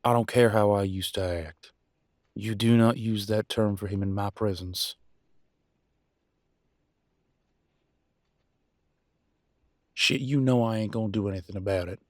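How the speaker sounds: loud, forceful rebuke; angry warning. Acted. Warning mate off using that term, angry and loud. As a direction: lay down the law, loud and firm. Acted quarrel, man warns listener off.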